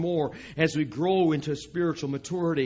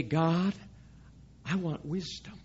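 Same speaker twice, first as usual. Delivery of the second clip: quiet prayer; 4 semitones lower, 10 dB quieter than usual. Acted. Believer's quiet prayer for wisdom.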